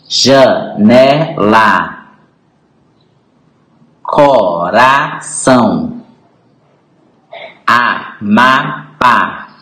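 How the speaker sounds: "Panela", "tapete", "Maranhão" split into three slow, separated syllables. Shown as a demonstration. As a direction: break each word such as "boneca" into bo, ne, ca with clear gaps. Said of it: "abre a boca três vezes para pronunciá-las". (sílaba por sílaba, pausadamente)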